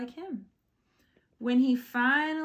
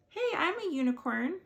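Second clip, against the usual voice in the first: cheeky rhinoceros; 6 semitones higher, faster than usual. Acted. Rhinoceros claims unicorn.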